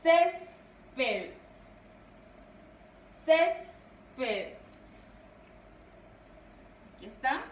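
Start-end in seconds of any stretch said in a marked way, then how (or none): 0.0-1.3 "Césped" with a loud, held CÉS, then a pause before PED; two separated syllables in "Césped", first one strongest; stressed first syllable. Shown as a demonstration. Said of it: "La mayor fuerza de voz". 3.2-4.5 two separated syllables in "Césped", first one strongest; stressed first syllable. Shown as a demonstration. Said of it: "La mayor fuerza de voz".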